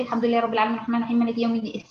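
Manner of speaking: mock fast recitation with the letters running together; imitating rushed reciting. A sister reciting too fast to her friend. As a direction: gabble through the verse. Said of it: "تلاقي بتقول: ... استني يا بنتي".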